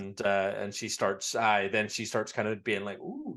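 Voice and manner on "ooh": soft, very high sigh of pleasure; mock relaxed ooh. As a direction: imitate someone sinking into a warm bath. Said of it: "does all the relaxed noises".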